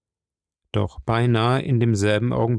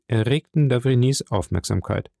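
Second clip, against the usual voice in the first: ordinary delivery, nothing marked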